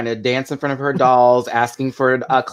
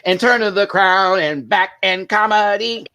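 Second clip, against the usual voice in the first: ordinary talk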